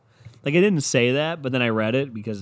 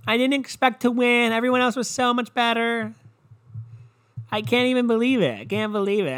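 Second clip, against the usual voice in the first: high, sustained gushing voice; hammed-up modest disbelief; mock humble award winner; 9 semitones higher than usual. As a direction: fake tearful-style acceptance speech, pitched up. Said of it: "It's always the same too".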